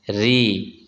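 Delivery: री with a light r and long ee; thin out the r of री, hold the ee. Shown as a demonstration. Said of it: "रा को बारीक पढ़ेंगे".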